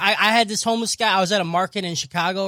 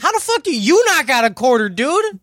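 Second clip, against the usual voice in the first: mock yell; 7 semitones higher, 7 dB louder than usual. Retelling yelling back at him.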